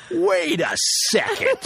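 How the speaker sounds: high, stretched-out protest; laughing imitation of a startled actor; mock alarm. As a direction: call a halt in hammed-up alarm. Acts out actor's alarmed realisation.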